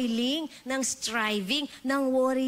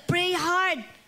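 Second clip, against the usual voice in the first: mock pray hard; 5 semitones higher, 3 dB louder than usual. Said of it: "dapat malakas ka mag-pray, sumisigaw ka".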